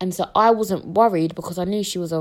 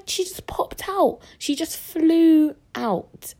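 amazed; 10 semitones higher, much slower than usual. Real, not acted. Excited reveal of quick birth.

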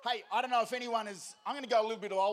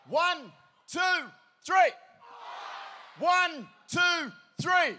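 high, shouted count-in; conteggio gridato; 9 semitones higher, 8 dB louder, much slower than usual. Real, not acted. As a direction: count the crowd in at full volume.

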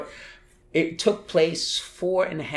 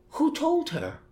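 surprised; slower than usual. Retold or imitated. Thinking at mother's surprise.

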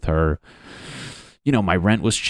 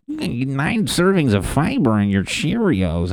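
high, slow, hammed-up ad announcer; cereal commercial voice; 7 semitones higher, slower than usual. Mocking the Cheerios commercial in a slow high ad voice. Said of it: "the commercials are talking about".

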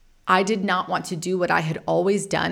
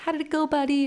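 much higher, eager retold question; high, keen fatherly check-in; excited dad; 10 semitones higher, faster than usual. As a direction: imitate an excited dad, pitch jumped right up. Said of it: "My dad all excited".

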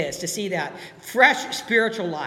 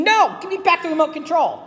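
higher-pitched, acted-out protest; firm refusal; 7 semitones higher, 3 dB louder than usual. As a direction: push back insistently, demanding it back. Acting out refusing the devil.

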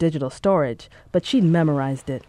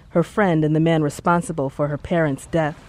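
ordinary delivery

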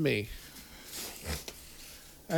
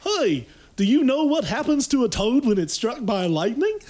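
impression; 6 semitones higher, 3 dB louder than usual. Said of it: "pick your impression".

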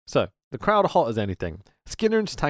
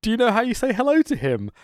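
high quote, then a loud slowed mock-greeting; imitating Heenan; 7 semitones higher, 4 dB louder than usual. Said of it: "And then Heenan goes".

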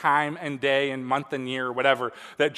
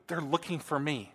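whispering on the phone; 10 dB quieter than usual. Retold or imitated. Little boy whispering on phone.

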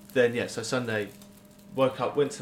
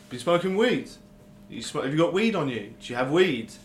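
questioning officer; 6 semitones higher, 5 dB louder than usual. Retold or imitated. Voices officer questioning them.

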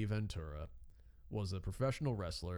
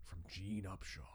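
hushed secret whisper; 2 semitones lower, 8 dB quieter than usual. Retold or imitated. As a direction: breathe the name conspiratorially. Conspiratorial locker room pitch.

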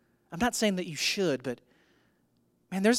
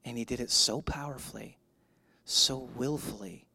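low, soft, measured delivery with pauses; hushed preaching; 6 semitones lower, 8 dB quieter than usual. Real, not acted. Pastor honoring a man who labored, hushed reverent preaching. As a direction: let it sink almost to a whisper by the end.

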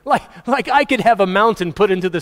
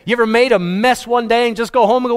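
ordinary talk

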